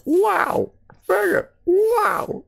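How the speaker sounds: high, frustrated yelps; exasperated squeal. Real, not acted. Gamer angry at his failed run, high frustrated yelps. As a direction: squeal at your own blunder. Screwed up the stage.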